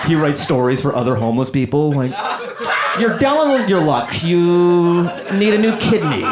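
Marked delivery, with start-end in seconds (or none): 3.0-6.2 pleading grifter pitch. Acted. Grifter's scripted sob story.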